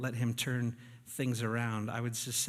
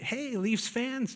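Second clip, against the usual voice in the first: fan cheer; 9 semitones higher, slower than usual. Retold or imitated. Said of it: "feel like such a poser".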